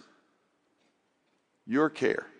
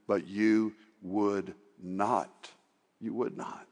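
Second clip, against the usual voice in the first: sorrowful, slowing; 4 semitones lower, 7 dB quieter, slower than usual. Acted. Grieving repetition.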